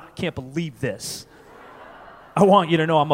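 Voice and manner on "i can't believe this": soft, deflated grumble under the breath; muttered quiet sulk. Retold or imitated. A quietly sulking boyfriend giving up on the argument. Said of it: "I just went".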